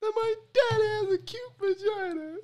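very high, helpless echo of a comic wail; mock-crying repeat of a punchline; imitating the wail. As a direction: squeal it back, breaking into laughter. Listener echoes the wailed punchline.

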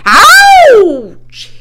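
very high, loud yelp of pain; screamed. A narrator's reading. Pig bitten on the tail, piercing scream. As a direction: scream it out long. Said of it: "he screamed".

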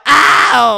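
loud, high "ow"; yelp of pain. Pretend. Player shot in the game, yelp of pain. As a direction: yelp as if just hit.

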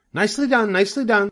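very high goose cry of praise; goose cheering. A narrator's reading. The goose praises Wilbur for dodging, crying out. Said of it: "cried the goose".

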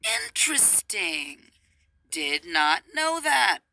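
very slow, mildly amazed deadpan. Retold or imitated. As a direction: draw it out, mock-impressed. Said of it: "a moment of".

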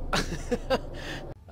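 breathless high laugh; nervous laugh. Real, not acted. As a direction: laugh off the fright. Nervous laugh after scare.

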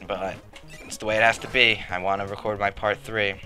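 ordinary talk, nothing marked

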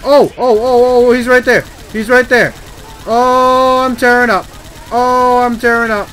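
high, very loud alarm; panicked shouting. Real, not acted. Player spotting the tank right there, panicked shouting. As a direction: yell it out in a real panic. Spots enemy, panics mid-game.

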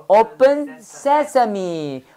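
"Open sesame" drawn out slowly; slow drill. Shown as a demonstration. Teacher drilling the magic words slowly. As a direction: stretch each syllable of "Open sesame". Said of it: "영어로 뭐야?".

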